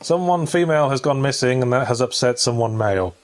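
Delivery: usual speaking voice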